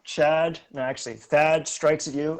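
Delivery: usual speaking voice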